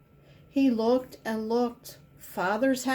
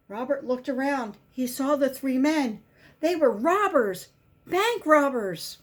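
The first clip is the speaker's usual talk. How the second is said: ordinary delivery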